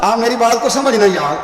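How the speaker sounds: high-pitched, urgent sermon delivery; impassioned preacher, pitched well up; fervent preaching. Acted. Preaching at full force.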